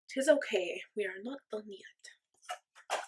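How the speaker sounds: slow, badly faked French-turned-Ukrainian accent; muddled foreign accent. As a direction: attempt a French accent that slides into Ukrainian, drawn out. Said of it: "I am so good at accents".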